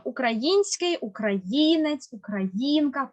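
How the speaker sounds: український, українець, українка, each slowly stressed on Ї. Shown as a demonstration. Teacher models the word family, український, українець, українка stressed on Ї. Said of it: "падає наголос на «ї»".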